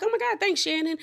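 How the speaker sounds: high-pitched gushing thank-you; excited thanks. Retold or imitated. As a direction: imitate a group gushing over a gift. Everyone thanks Shannon for the gift.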